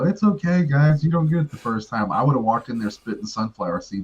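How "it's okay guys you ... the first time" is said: high-pitched, over-nice coach reassuring everyone; nice hugging coach. Acted. Coach hugging the nervous class, mock-nice reassurance. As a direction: comfort the class in a raised, sweet put-on voice. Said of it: "a nice homo and hugged everybody and go".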